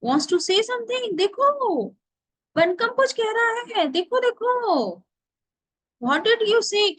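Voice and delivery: high, exaggerated mock astonishment; feigned amazement. A narrator's reading. Villager teasing Bonku before the others, in feigned amazement. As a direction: act hugely amazed, voice swooping up high. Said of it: "Nidhu Babu फेन total amazement".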